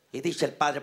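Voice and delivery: ordinary talk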